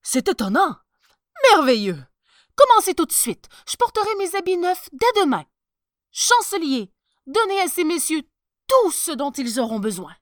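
overjoyed and ordering everyone about; delighted emperor. Acted. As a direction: emperor thrilled with the magic cloth.

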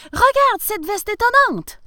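high, loud, proud exclamation; excited. A narrator's reading. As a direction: show it off to the wife excitedly. Excited professor shows wife the jacket.

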